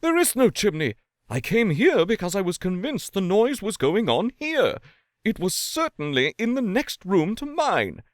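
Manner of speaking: high, agitated protest; agitated insistence. A narrator's reading. Lawyer insisting the noise came from next door, agitated. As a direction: insist hard, voice up. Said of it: "said the lawyer".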